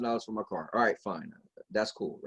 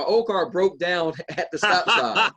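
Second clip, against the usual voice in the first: laughing; 3 semitones higher, 5 dB louder, slower than usual. Real, not acted. Laughing at his own punchline.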